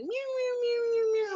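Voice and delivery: high meowing like a kitten; cat meows. Retold or imitated. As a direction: meow like a little girl playing cat. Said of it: "I'm like Stop".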